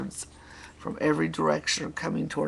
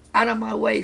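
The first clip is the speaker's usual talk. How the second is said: raised-pitch story villain's snarl; understated bossy snarl; mild snarl; 8 semitones higher, 7 dB louder, faster than usual. A narrator's reading. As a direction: snarl it lightly at a rival. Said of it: "snarled Stinky".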